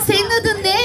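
ordinary delivery, nothing marked

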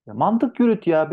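high, loud exasperated plea; exasperated scolding. Real, not acted. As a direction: come on, think about it. Öğrenciye sitem eden hoca. (sitemli, yüksek sesle çıkışma)

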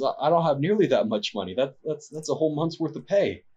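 a poor man caught out, frightened; panicked villager. Acted. Poor villager to the nobleman, a touch of panic.

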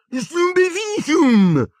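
very high, drawn-out cry; muffled-nose boy shouting a spell; shouted spell. Acted. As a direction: yell the spell high and slow. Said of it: "gridò Neville".

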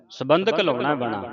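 usual speaking voice

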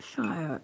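"Shire" said as a full word rhyming with fire; mispronounced Shire. Shown as a demonstration. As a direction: say "Shire" with a long I and an -er ending. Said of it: "they see I R E as Shire".

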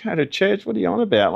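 high-pitched, baffled comeback; retold scoffing surprise; incredulous retort. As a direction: throw the invitation back in disbelief. His startled reply to girlfriend.

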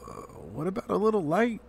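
nervous little hobbit voice pleading apologetically; apologetic. A narrator's reading. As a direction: shyly ask for a bit of light, afraid to offend.

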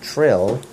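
"trill" with an R after the T; mistaken trill. Shown as a demonstration. Parent rejects child's not trill, echoing "trill" with R. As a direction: show the error "trill", R left in. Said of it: "No R".

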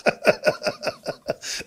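very high, squealing blubber; mock sobbing. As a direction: dissolve into high-pitched fake sobs. Man wails over imagined sum. (יבבות גבוהות מעושות)

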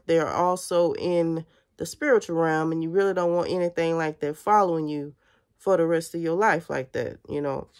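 ordinary talk, nothing marked

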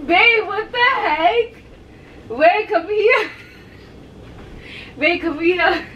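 laughing pleading. Real, not acted. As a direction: plead while laughing helplessly. Partner walks off, laughing.

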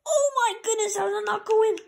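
high, loud cry of disbelief; disbelieving outcry. Real, not acted. Gamer reacts to a missed chance, disbelieving outcry. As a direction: wail at the near miss.

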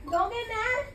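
ordinary delivery

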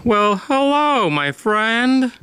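high, slow sing-song menace; creepy mock-friendly greeting. Killer finding the hidden victim, high and slow sing-song. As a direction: tease the victim sweetly.

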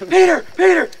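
high, loud shout of his name; calling for help. Retold or imitated. Hurt man calling his roommate again, loud shout. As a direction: yell for your roommate to come down. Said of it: "so I'm calling my friend".

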